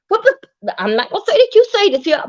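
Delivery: mock angry outburst. Voicing M U's angry retort at Teresa. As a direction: fire back at her, indignant. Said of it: "in Dover Nietzsche way".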